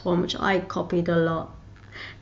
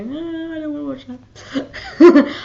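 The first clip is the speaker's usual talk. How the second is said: mock whiny refusal; 7 semitones higher, 8 dB louder, slower than usual.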